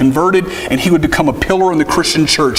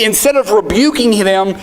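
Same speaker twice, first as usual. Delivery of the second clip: normal talking voice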